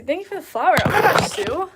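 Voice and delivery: loud, high delighted thanks; excited. Real, not acted. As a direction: shout your thanks with joy.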